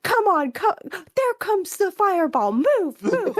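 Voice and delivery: imitated gamer urging. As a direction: hurry the character along, half joking. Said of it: "he's like".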